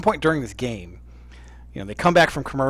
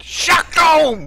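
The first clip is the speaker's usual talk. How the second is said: high, loud, drawn-out shout; mock catchphrase yell; 6 dB louder than usual. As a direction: holler it like a running joke. Yelling the catchphrase at friend.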